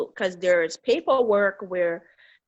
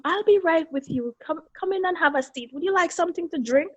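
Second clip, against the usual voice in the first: bright, higher polite office voice, quick; mock-polite receptionist voice, higher and fast; 8 semitones higher, faster than usual. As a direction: lift the voice into a sweet front-desk tone. Mother quotes the receptionist.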